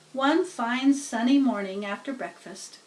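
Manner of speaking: plain reading voice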